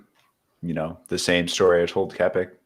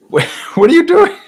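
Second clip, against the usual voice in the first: squeaky high laughing "what are you doing", much higher and louder; laughing disbelief; 9 dB louder, faster than usual. Retold or imitated. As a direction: crack up while playing the shocked friend. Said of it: "and he's like".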